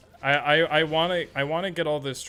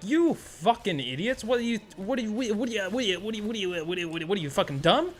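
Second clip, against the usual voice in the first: mock exasperation; 6 semitones higher, faster than usual. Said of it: "I'm like".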